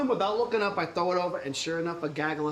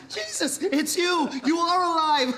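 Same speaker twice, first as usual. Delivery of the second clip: overjoyed; 11 semitones higher, slower than usual. Acted. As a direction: call out in amazed delight. Peter greets risen Jesus.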